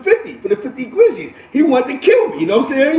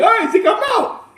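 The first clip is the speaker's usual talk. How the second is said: much higher, laughing imitation of the buyer; mock buyer voice; 13 semitones higher than usual. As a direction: toss it off as the hurried buyer, laughing. Buyer leaves, voiced by teller.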